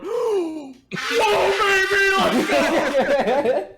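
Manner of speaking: very high, loud squealing cheer; hammed-up ecstatic shriek, breaking into laughter; Pippin. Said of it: "and Pippin just going".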